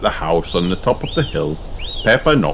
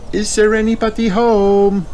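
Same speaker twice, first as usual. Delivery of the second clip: high, louder voice calling into the house; Mummy Pig asking; 5 dB louder than usual. A narrator's reading. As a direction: call into the empty cottage. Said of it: "asked Mummy Pig".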